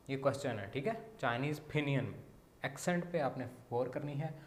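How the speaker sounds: usual speaking voice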